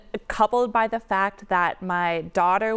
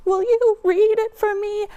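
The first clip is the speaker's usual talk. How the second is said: high, nervous, trembling ask; shaky voice; 13 semitones higher, 5 dB louder than usual. Retold or imitated. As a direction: voice shaking with nerves. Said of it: "with a shaking voice I said".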